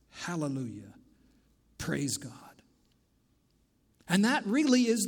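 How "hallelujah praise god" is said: low, soft, near-whispered reverence; hushed praise. Real, not acted. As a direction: let the praise sink to a hush. Hushed praise after the message.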